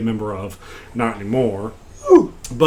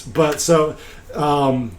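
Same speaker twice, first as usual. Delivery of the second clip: ordinary talk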